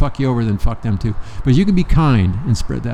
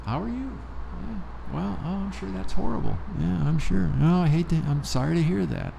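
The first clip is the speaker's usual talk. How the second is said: high, soft, sugary mock concern; fake sympathy; 6 semitones higher, 11 dB quieter than usual. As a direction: coo it with hollow politeness. Mocking polite small talk.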